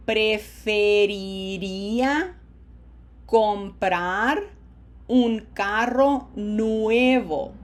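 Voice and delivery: slow, carefully drawn-out Spanish, long vowels; slow model sentence. Shown as a demonstration. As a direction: model it loudly word by word for learners. Teacher models a sentence for repetition.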